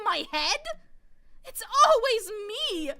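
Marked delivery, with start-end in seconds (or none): 1.4-2.9 louder, slow cry of frustration; anguished outburst. Acted. Natsuhi outburst.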